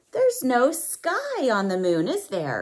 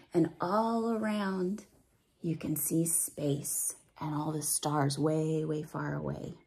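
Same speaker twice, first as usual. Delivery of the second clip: hushed, softer whisper trailing off; 6 semitones lower, 8 dB quieter than usual. Acted. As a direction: whisper it like a secret of space. Quiet awe at space.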